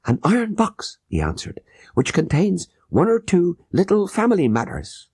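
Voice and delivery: a small fat merchant pleading; merchant Achmet. A narrator's reading. Merchant voiced, high.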